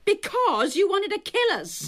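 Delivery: high, heated comeback; angry accusation. Acted. Lillian accuses Matt over the car ride, angry. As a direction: hurl the accusation back, pitch leaping up.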